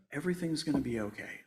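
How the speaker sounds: soft, quiet inner voice; gentle voice heard. Retold or imitated. Remembering a voice comforting him in line, soft and quiet. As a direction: say it softly like a voice of comfort. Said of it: "I heard a voice say".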